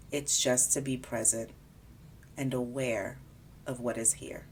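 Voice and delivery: calm, unhurried body scan guidance; guided meditation. Acted. Guiding a listener through a body scan, calm meditation voice. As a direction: ease the listener gently from part to part.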